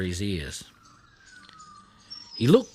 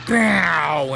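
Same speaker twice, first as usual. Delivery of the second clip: louder engine growl imitation; car growl; 5 semitones higher, 6 dB louder than usual. A narrator's reading. The car roaring past Blinky, loud growl. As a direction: make the car roar past.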